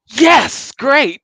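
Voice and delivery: loud, high-pitched burst of delight; delighted reaction. Retold or imitated. Host reacting to viewers who disagree, loud and delighted. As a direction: exclaim it high and loud, thrilled. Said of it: "And I'm like".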